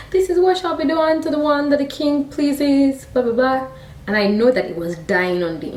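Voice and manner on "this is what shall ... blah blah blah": high, sustained town-crier call; shouting proclamation. Retold or imitated. Haman announcing Mordecai through the city, mock shouting. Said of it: "ringing a bell and shouting".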